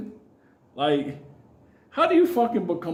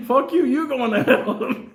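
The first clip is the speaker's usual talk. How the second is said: higher, slower, laughing "you're going to hell"; mock comeback; 7 semitones higher, 3 dB louder, slower than usual. Answering churchgoers with a mocking laughing comeback. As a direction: throw it back at them, amused. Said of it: "I'm like".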